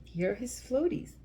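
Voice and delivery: ordinary delivery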